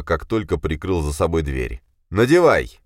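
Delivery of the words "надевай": harsh order. A narrator's reading. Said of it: "он протянул Кате антимагические наручники".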